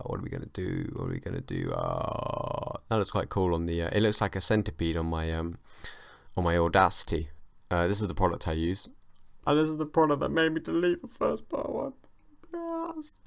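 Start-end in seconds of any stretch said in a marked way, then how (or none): 0.1-2.8 very low, softer groaning voice; mock despair. Mock despair over lost recording. 9.5-13.1 very high, put-on whine; mock lament. Mock lament over deleted podcast.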